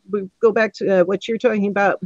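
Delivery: ordinary delivery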